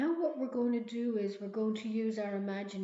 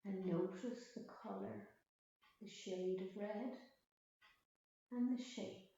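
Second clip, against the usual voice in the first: guided meditation; 6 dB quieter than usual. Acted. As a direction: ease them back to the breath. Leads a mindfulness meditation.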